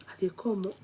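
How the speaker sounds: ordinary talk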